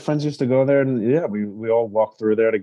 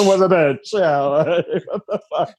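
laughing; 5 semitones higher than usual. Real, not acted. Laughing repeat.

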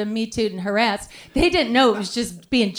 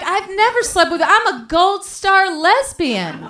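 mock indignant server; 6 semitones higher, 4 dB louder than usual. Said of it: "she was like".

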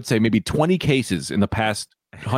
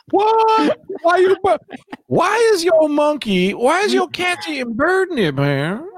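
mock outrage; slower than usual. Friend's cats eat bird beaks, answered with high shocked disbelief. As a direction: yelp it in fake shock. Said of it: "mock disbelief at a friend's joke".

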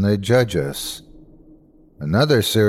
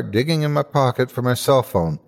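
usual speaking voice

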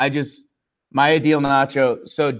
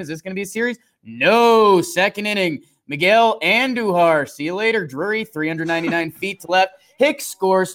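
punchy play-by-play, pitched up from chat; sports recap announcer; 6 semitones higher than usual. Acted. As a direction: call the game highlights like an announcer, with energy. Rapid game recap in announcer style.

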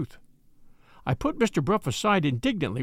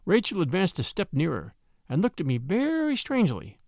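plain reading voice

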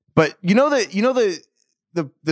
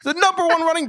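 movie-quote hype; 14 semitones higher than usual. Retold or imitated. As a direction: crow it like a cocky racing champ. Mock-hyping Duke Johnson as Ricky Bobby.

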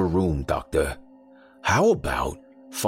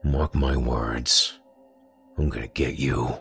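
low threat; 4 semitones lower than usual. A narrator's reading. Grizz threatening doctor.

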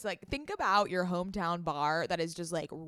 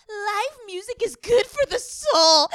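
high, slow, dreamy mock quote; mocking imitation; 14 semitones higher, 14 dB louder, slower than usual. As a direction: parrot her earnest line back, overdone. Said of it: "and then you were like".

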